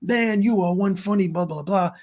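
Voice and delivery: high-pitched mimicked fan gushing; gushing praise. Stranger praising the comedian, gushing imitation. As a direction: imitate a fan, tailing into blahs.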